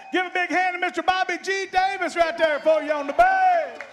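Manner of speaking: loud, high ringing stage announcement; announcer introduction. Real, not acted. Bandleader presenting the bass player to the crowd, full-force announcer. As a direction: belt it out for applause.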